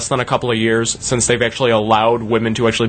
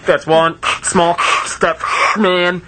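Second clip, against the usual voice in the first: Neil Armstrong; 4 semitones higher, slower than usual. Retold or imitated. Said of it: "Just quoted Neil Armstrong there".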